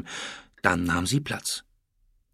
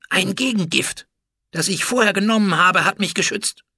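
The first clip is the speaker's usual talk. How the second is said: high-pitched, raised-voice gloating; thinly smiling defiance; 10 semitones higher, 6 dB louder than usual. A narrator's reading. Food taster explains his antidote to the queen, smug defiance. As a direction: answer through a thin smile. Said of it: "Mentmose lächelte dünn".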